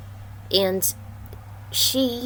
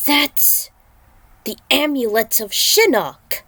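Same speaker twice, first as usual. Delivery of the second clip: startled exclamation; 6 semitones higher, 5 dB louder than usual. Acted. Ninjas recognise the amulet.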